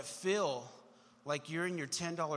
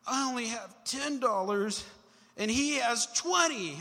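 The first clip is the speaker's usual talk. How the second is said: higher, slow, mock tearful complaint; whiny crying kid; 5 semitones higher, 4 dB louder, slower than usual. Little boy tells mom about his brother's money, mock tearful whine. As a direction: sulk it out like a wronged little brother. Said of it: "big crocodile tears".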